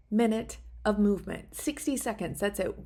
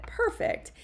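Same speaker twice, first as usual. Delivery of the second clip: breathy high perfect; 14 semitones higher than usual. Real, not acted. Finishing timed minute, excited.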